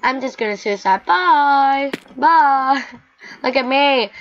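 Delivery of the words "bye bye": higher, louder farewell; cheerful bye. Real, not acted. Gamer jumping off, high loud bye. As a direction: wave it off gleefully.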